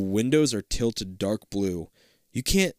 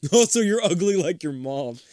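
laughing repeat; 7 semitones higher than usual. Pretend.

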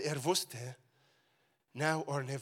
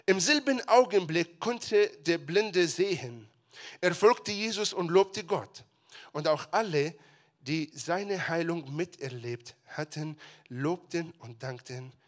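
louder, earnest Bible reading; scripture reading; 6 dB louder than usual. Acted. Pastor reading the healing passage aloud, earnest. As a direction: read it to the congregation with weight.

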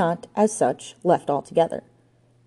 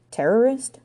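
higher, rising surprised echo; echoed question; 6 semitones higher than usual. A narrator's reading. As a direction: repeat the word as a startled question.

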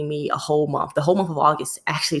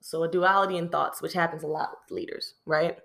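usual speaking voice

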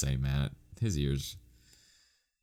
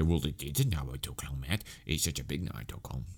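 softer, snide imitation of a smug friend; mocking know-it-all; 5 dB quieter than usual. Friends mocking Matt about the Naruto clone, in a snide soft voice. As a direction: sneer it like a smug friend correcting you. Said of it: "some people I know will obviously say".

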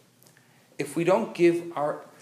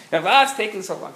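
put-on loud, higher impatient old lady yell; mock yell of an impatient old woman; old lady yelling in the guru line; screaming; 6 semitones higher, 4 dB louder than usual.

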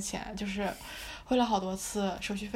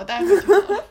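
louder, higher laughing remark; 9 semitones higher, 15 dB louder than usual. Real, not acted. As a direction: burst out laughing as you say it. Laughing at own story.

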